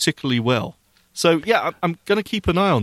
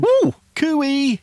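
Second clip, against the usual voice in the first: high, loud whooping call; excited cheer; 11 semitones higher, 3 dB louder than usual. Real, not acted. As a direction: whoop it up like calling across hills. Cheering on arriving at forest.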